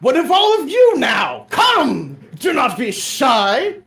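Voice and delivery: loud, high, theatrical knightly speech; rally the party like a storybook knight; grand knight. Acted. Quixote rallying the party, loud theatrical knight.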